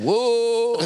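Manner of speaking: mock scream.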